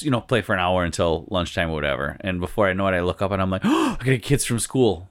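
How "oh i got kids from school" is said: sharp gasp, then mock alarm; startled realisation. Player looks up at school pickup time, startled. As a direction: gasp as if suddenly remembering.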